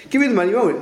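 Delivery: high, insistent retold demand; acted demand. Servant demanding money from his debtor, insistent demand.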